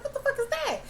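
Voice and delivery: squeaky high spooked question; shocked. Real, not acted. Viewer hearing turkey-like monster sounds, high shocked question. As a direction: voice leaps up, freaked out. Reacting to eerie noise again.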